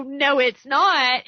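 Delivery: stubborn slow retort in a mild quarrel; firm denial. Retold or imitated. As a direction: plant your feet on each word. Retold back-and-forth argument.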